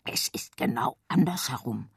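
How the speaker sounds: low unvoiced secret whisper; meaningful whisper. A narrator's reading. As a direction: whisper it knowingly. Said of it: "wisperte bedeutungsvoll".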